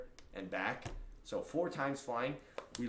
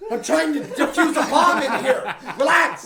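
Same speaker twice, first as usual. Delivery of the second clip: loud, high, slow mock shout; exasperated yell; 13 semitones higher, 17 dB louder than usual. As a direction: snap back at the chatty neighbor. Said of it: "I'd be like".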